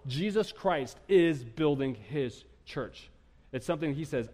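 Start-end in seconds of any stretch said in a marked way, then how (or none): 0.1-3.1 very slow, word by word; slow insistence. Acted. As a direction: lay each word down slowly. Insistent preaching point.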